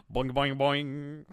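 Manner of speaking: slow, stretched boing, boing, boing; sung boing sound. Acted. Co-host sings a boing sound to mock him slowly. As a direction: sing it out slow like a cartoon spring. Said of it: "as you sing boing a boing a boing".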